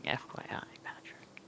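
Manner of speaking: very soft, breath-only whisper; whispered aside. Acted. Correcting Patrick on the side, whispered. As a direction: slip it in under your breath. Muttered aside to a fellow player.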